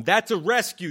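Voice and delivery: comic ranting at the hippie; indignant shout. Acted. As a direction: hammer home the point.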